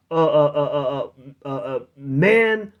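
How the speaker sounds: ordinary talk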